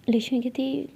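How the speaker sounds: ordinary delivery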